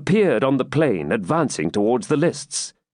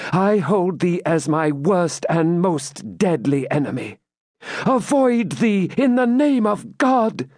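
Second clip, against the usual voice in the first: higher, firm voice, slow on the final curse; Rebecca defiant; 8 semitones higher than usual. Acted. Rebecca rejecting the Templar's offer, higher and defiant. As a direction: refuse him to the last.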